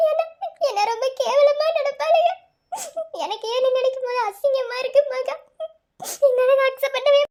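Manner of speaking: distressed and crying; tearful shame. Acted. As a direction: catch your breath between ashamed phrases. Ashamed girl pleads with friend.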